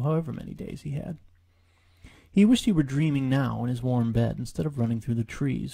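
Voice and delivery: ordinary delivery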